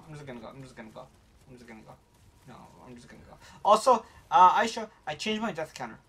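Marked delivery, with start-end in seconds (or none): 0.1-3.4 each repeat much softer and lower; fading out. Real, not acted. Streamer backing away from a fight, getting softer. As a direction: trail off into a mumble.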